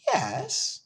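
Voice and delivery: high-pitched, mildly hurt little pig. A narrator's reading. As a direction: answer as a slightly wounded pig. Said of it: "a little hurt".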